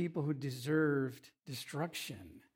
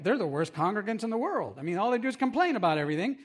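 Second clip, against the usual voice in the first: louder, higher and rushed; exasperated complaint; 5 semitones higher, 5 dB louder, faster than usual. Retold or imitated. Moses complaining to God about his people, hammed-up exasperation. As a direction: vent it fast like a fed-up pastor.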